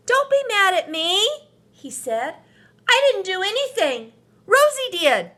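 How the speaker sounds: put-on high, loud child voice pleading; pleading little boy. A narrator's reading. Marvin pleading with his angry sister, high and loud. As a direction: beg her not to be angry.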